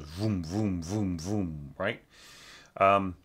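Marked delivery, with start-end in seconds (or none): 0.0-1.7 imitated bow vroom. Shown as a demonstration. Teacher imitating big down bows for the student. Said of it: "these big down bows".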